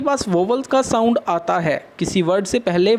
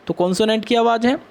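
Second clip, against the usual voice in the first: normal talking voice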